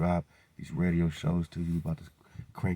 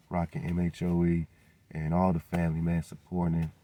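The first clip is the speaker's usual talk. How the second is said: ordinary delivery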